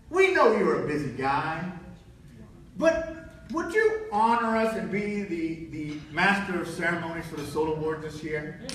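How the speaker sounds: low, measured official voice; lightly voiced board chairman making a request; a hint of a formal chairman; chairman's invitation. Chairman's phone invitation acted out.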